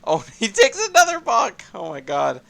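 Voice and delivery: high laughing outburst; laughing exclaim. Real, not acted. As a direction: laugh through the call. Caster sees repeated crash.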